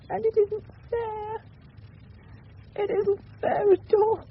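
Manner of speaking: rising high into tears, voice breaking; crying. Acted. Grieving wife to the boy, breaking into tears. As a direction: let it break into sobs. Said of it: "Don't cry".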